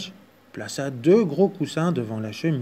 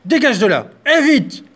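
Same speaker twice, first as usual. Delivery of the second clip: loud, higher furious yell; yelling bear; 10 semitones higher, 9 dB louder than usual. Acted. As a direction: chase the mouse out. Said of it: "cria l'ours".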